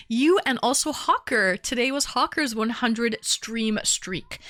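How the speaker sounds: normal talking voice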